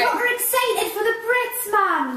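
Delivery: high-pitched mimicry of a bandmate's accent; [name]'s accent. Teasing a bandmate by echoing her, exaggerated accent imitation. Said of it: "when you do my accent".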